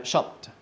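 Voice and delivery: "shopped" as one syllable ending in a T. Shown as a demonstration. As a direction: demonstrate "shopped" with a final T. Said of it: "sound a little bit like a T".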